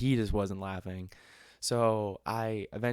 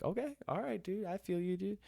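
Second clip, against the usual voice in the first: much higher, airy and dismissive; breezy brush-off; 10 semitones higher, 4 dB quieter than usual. Retold or imitated. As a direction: toss it off lightly, sky-high and unbothered. Brushing off the heckler's insult.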